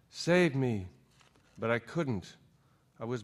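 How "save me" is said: plain reading voice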